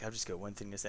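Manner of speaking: low, soft lead-in to a gag; understated put-on murmur; mock hushed setup. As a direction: drop low and quiet before the outburst. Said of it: "then it's just me like".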